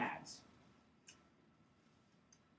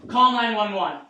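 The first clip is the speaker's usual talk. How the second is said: firm, raised order; loud command; 3 semitones higher, 13 dB louder than usual. Acted. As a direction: bark the order at a bystander. Rescuer ordering a bystander.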